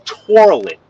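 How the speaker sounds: TOILET stretched out with an r, like "torlet"; high, drawn-out Queens TOILET; Queens pronunciation. Shown as a demonstration. Repeating for co-host what Queens people say, Queens pronunciation. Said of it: "a Queens person will say".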